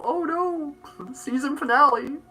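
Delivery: high, squealing mock alarm, laughing; hammed-up dismay with a laugh; mock panic. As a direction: pretend to be shocked the show is ending. Mock panic at show ending.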